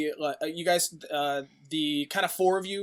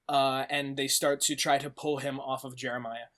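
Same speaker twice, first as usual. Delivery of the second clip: ordinary delivery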